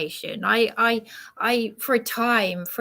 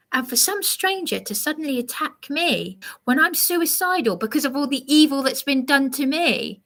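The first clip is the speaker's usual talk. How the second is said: normal talking voice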